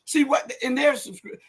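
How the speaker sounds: ordinary talk, nothing marked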